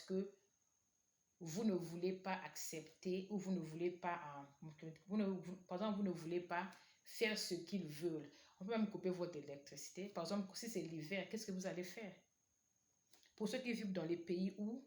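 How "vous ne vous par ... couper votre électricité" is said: ordinary talk, nothing marked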